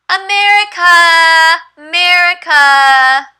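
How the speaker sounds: high, very slow, drawn-out storytelling; teacher voice. Shown as a demonstration. Teacher explains China and America to her student, high and drawn out. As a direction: stretch America out like a long journey.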